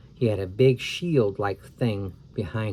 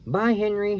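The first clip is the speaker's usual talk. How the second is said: much higher, sing-song goodbye; high girl farewell; 9 semitones higher than usual. Acted. Girl saying goodbye to pteranodon, high. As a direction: wave him off in a high voice.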